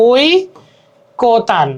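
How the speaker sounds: ওই কোতান split as "oi", pause, "ko-tan"; correct pronunciation. Shown as a demonstration. Teacher showing correct pronunciation, ওই কোতান in separated parts. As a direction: break ওই from কোতান, giving a clear "oi". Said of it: "সঠিক উচ্চারণ করতে হবে যে".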